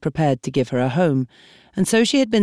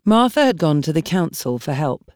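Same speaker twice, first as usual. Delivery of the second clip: usual speaking voice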